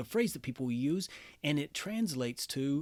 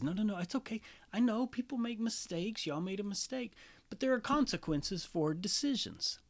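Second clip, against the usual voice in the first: gentle God; 8 semitones higher than usual. Retold or imitated. God voiced kindly.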